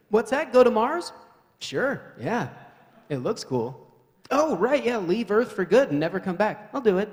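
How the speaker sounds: loud, high, breezy yes to leaving Earth; eager Mars volunteer. Acted. Volunteer brushing off the risks, loud and breezy. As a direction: shrug off leaving Earth forever, cheerfully. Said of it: "the mindset of that person is like".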